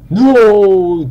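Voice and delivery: loud, high drawn-out protest; dismayed cry. Real, not acted. Gamer sees an unwanted player coming, loud dismayed cry. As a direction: cry out as it goes wrong.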